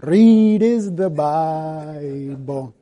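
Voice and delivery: put-on very slow sung line, "read" with a rolled R in an Indian accent. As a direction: roll the R in "read" and stretch it out Indian style. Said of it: "they kinda roll their R's".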